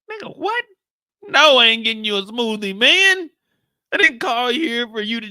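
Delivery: high, loud disbelief; mock outrage. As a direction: react like it's absurd. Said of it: "outraged reply".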